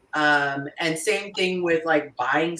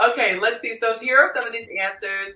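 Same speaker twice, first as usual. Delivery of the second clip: usual speaking voice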